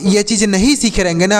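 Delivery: ordinary talk, nothing marked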